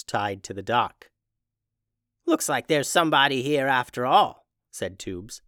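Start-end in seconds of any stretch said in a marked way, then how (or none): 2.3-4.5 lightly voiced old witch; a hint of a brisk old woman. Tubes the old witch speaks.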